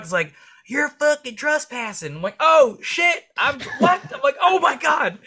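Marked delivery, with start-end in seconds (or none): 0.7-2.2 much higher, slow, shrill-mocked accusation; mock the furious lady who caught you; angry landowner lady. Landowner to trespasser, high mock accusing voice. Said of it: "some lady comes out speeding". 2.4-5.2 much higher and louder, squeaky mock panic; panicking. Caught trespassing, squeaking in mock panic. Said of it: "I was, like, panicking".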